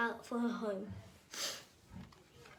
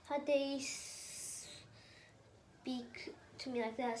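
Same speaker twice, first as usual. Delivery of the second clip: witch snarl; 3 dB quieter than usual. Acted. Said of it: "snarled the witch".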